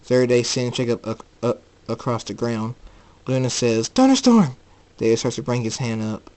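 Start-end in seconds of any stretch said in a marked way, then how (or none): 3.9-4.6 loud, high-pitched call; alarmed cry. Acted. Luna seeing her son thrown, crying out.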